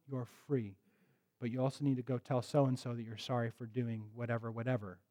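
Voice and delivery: soft, gentle reassurance; reassuring parent. Acted. Parent on the child's level, reassuring them they are loved, softly. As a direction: kneel down and comfort.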